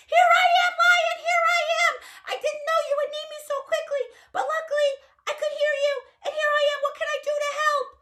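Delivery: very high, loud, fast little mouse; squeaky mouse voice. Acted. Little Mouse running to rescue Lion, high and quick. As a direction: chirp it out like an excited tiny mouse.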